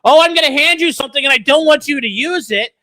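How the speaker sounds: loud, high mock argument; mocking retelling. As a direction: imitate an absurd excuse with exasperation. Mocks lawyer's position in rant.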